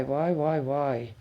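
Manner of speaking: ordinary talk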